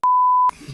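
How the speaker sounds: high burst of laughter. Real, not acted. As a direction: break into a high, quick laugh. Laughs at crowd's shocked reaction.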